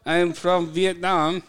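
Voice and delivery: high, slow put-on Vietnamese accent; hammed-up fake Vietnamese phone greeting; a bad, jokey Vietnamese accent; mock Vietnamese accent. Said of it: "[name]'s Vietnamese impression".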